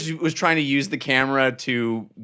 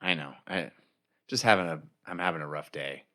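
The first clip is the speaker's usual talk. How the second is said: much lower, soft, mopey creature voice; glum octopus voice; 11 semitones lower, 10 dB quieter than usual. Acted. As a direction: voice a sulky octopus, low and quiet. Octopus complains about photos.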